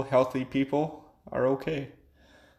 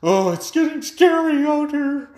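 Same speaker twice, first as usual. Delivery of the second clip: high, loud whiny mockery of a frightened person; mocking scared people; 12 semitones higher, 7 dB louder than usual. Ranting vlogger mocks people scared by pizza delivery, high whiny voice. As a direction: squeal it like a panicked neighbor. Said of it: "People are all".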